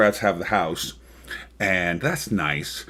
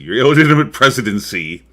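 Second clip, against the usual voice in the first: raised, louder voice of rejection; emphatic rebuke; 6 semitones higher, 10 dB louder, faster than usual. Retold or imitated. Voicing America's rejection of Trump's presidency, emphatic rebuke. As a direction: deliver a forceful, quickened denunciation. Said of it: "America's way of saying".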